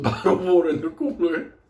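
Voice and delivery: ordinary talk, nothing marked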